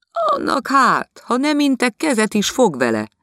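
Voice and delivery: amused lady of the house, high and loud; gossiping lady. Acted. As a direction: scoff at the maid like a gossiping hostess.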